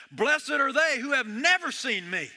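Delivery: emphatic preaching of the blessing. Acted. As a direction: drive the word "never" home.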